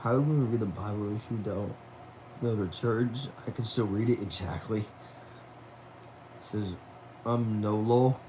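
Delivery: ordinary delivery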